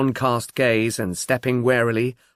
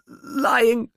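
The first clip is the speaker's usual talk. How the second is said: much higher, near a wail; tearful plea, breaking; 11 semitones higher than usual. Acted. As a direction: let the word crack as it rises. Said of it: "she pleaded with a catch in her voice".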